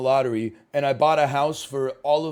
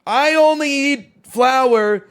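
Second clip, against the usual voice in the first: mocking snob; 6 semitones higher, 8 dB louder, slower than usual. Mocking a picky chatter.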